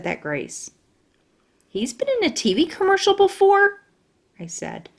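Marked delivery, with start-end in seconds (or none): 1.7-3.8 high amazed question; astonished. Acted. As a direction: echo it in disbelief. Astonished repeat.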